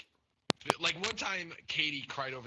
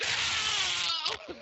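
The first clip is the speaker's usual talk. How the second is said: high, loud wailing no; mock anguished family shout; 9 dB louder than usual. Family reacting to grandpa mention, loud high wail. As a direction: cry out in mock grief. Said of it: "my whole fucking family was like".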